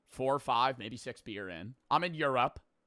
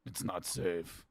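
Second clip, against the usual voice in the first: ominous local warning; 8 semitones lower, 4 dB quieter, slower than usual. Retold or imitated. Bar local warning a drunk tourist, low, slow and ominous. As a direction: lower and slow, a hushed grave warning. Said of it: "a local leans in and goes".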